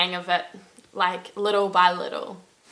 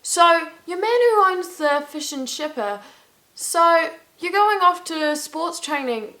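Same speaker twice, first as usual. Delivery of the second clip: high, put-on local chatter; pitched-up quoted small talk; Irish chat imitation; 8 semitones higher than usual. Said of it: "or so".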